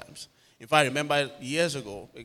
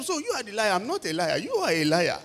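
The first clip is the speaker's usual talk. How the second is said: high-pitched retold accusation; acted-out heated retort; heated argument; 7 semitones higher, 4 dB louder than usual. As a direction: throw the accusation back, stressing who is meant. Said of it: "the exchange was too heated".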